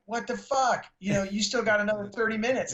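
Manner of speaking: mock-exasperated promoter; imitating Gary Tovar.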